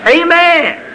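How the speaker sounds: high-pitched preacher's call; ringing amen from the pulpit. Real, not acted. Preacher calling out to congregation.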